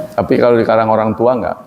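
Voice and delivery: usual speaking voice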